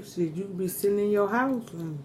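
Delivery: ordinary talk, nothing marked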